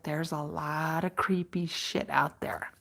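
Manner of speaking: mock-ominous ranger warning; creepy low warning. Ranger warns a ghost hunter, low and spooky. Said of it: "she's like".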